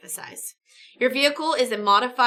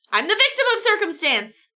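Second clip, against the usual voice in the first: mock indignant protest; 10 semitones higher, 4 dB louder than usual. Camper voiced defending himself.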